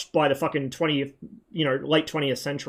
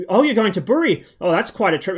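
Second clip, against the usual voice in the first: put-on surprised old man; 4 semitones higher, 5 dB louder than usual. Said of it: "not in that accent".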